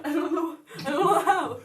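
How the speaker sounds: embarrassed high-pitched refusal through laughter; laughing protest. Real, not acted. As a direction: beg off, giggling, pitch up. Pressed to perform, laughing refusal.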